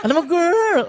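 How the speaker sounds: very high, laughing imitation with croak; croaky mock imitation. Repeating colleague's phrase for co-host, croaky and laughing. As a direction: stretch "girl" high through laughter. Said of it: "croak in your throat".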